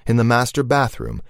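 ordinary delivery, nothing marked

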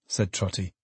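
ordinary delivery

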